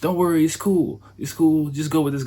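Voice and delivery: high cheerful reassurance over the phone; Mister Kawasaki. Retold or imitated. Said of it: "it's like, Mister Kawasaki. He's like".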